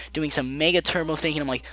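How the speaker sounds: usual speaking voice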